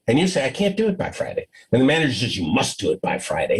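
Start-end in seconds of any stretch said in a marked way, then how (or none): 0.4-1.5 higher, quick, softer protest; a nervous employee refusing, higher and hurried; meek refusal. Retold or imitated. 2.4-3.6 much lower, firm insistence; a lightly voiced stern boss; stern manager.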